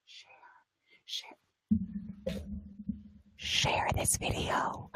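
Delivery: slow, hushed whisper; secretive whisper. Shown as a demonstration. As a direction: let them in on a secret. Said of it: "now I'm telling you a secret".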